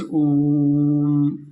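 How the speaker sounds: ओम held very long and slow; drawn-out om. Shown as a demonstration. Teacher shows students a long drawn-out vowel, very slow. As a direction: stretch ओम out like a chant. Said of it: "जिसमें कोई चीज़ को लंबा करके".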